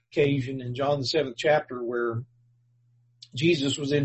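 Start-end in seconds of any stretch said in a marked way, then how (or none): none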